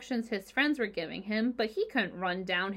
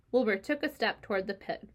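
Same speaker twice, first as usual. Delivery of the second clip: usual speaking voice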